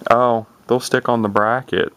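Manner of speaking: ordinary talk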